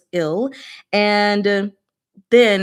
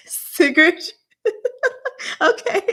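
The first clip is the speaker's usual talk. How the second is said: high, laughing voice; words squeezed out through high laughter; giggling; 13 semitones higher, 4 dB louder than usual. Real, not acted. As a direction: break into giggles as you speak. Giggling over saying the name.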